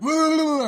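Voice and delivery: sudden loud high jump-scare yell; hammed-up jump-scare roar; mock scare shout. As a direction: blurt a loud high boo to startle.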